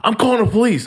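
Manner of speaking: high, sharp threat; threatening attendant. Retold or imitated. Tow guy threatening the teller with police, high sharp threat. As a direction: warn him up high.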